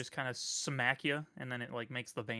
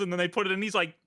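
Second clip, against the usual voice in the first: usual speaking voice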